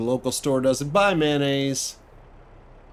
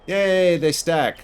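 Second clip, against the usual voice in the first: happy cheer; 5 semitones higher, 4 dB louder, slower than usual. Real, not acted. Stacking pallets finally works.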